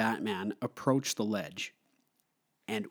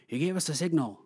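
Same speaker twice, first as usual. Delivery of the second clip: Gordon line; 6 semitones higher, faster than usual. Retold or imitated. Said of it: "And Gordon says".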